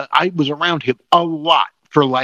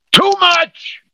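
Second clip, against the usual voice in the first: very high, sudden yell; outraged shout; 4 dB louder than usual. Real, not acted. Host on wash trading on Blur, outraged shout. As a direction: shout the answer to your own question. Host answers own question, exclaiming.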